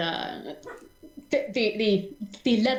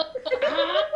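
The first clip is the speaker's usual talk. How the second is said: high startled yelp with a laugh in it. Acted. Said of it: "Tito looks at him and goes".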